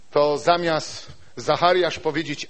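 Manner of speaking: ordinary delivery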